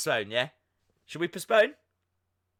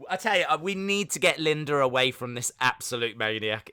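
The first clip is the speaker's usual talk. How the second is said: usual speaking voice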